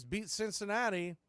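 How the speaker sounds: ordinary delivery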